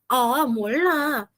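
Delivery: "ah, molá" slow, each vowel sung long and falling; Korean drama intonation. Retold or imitated. Teacher showing how Koreans always say it, stretched intonation. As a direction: draw out "ah, molá" expressively. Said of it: "eles sempre fazem essa entonação".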